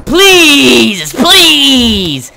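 high loud pleading cries; loud desperate pleading. Real, not acted. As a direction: plead in real frustration. Begging the broken game.

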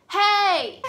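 loud, high, drawn-out hey; calling out. Retold or imitated. Man calls to woman across the park, calling out. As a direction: imitate someone hailing from a distance.

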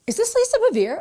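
high, loud caller's question. Retold or imitated. Officer on the phone asking for Lisa, high and loud. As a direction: pitch the stranger's voice up high.

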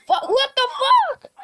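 high-pitched shocked exclamation; real startled yelp of words. Real, not acted. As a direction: blurt it out in disbelief, pitch jumping up. Startled reaction during the game.

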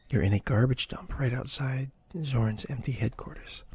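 ordinary delivery, nothing marked

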